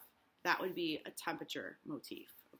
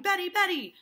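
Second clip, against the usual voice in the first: high, loud, urgent calls; crying out; 11 semitones higher, 13 dB louder than usual. Acted. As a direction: cry the name out in panic.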